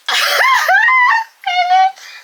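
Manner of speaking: loud, very high squealing laugh; helpless laughter. Real, not acted. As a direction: burst out in a real shrieking laugh. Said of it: "These comments got me in shambles".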